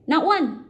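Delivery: high-pitched punch on not one; not one, stressed high and loud; emphatic high stress. A narrator's reading. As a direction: land it hard and high. Narrator stresses the point.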